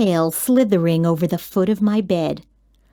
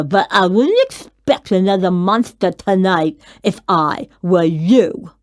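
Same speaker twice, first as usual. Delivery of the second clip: sulky monster; 4 semitones lower than usual. A narrator's reading. Mac sulks and leaves.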